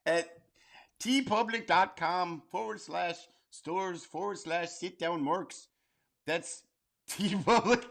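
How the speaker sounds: high-pitched mock Minnesota accent; singsong put-on Minnesota accent; Bobby's mom from Bobby's World. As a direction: read out the plug as a hammy Minnesota mom. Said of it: "like Bobby's mom from Bobby's World".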